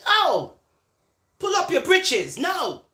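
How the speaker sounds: loud, high-pitched telling-off; scolding. Acted. Telling followers off over double standards, loud scolding. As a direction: lay down the law, high and loud.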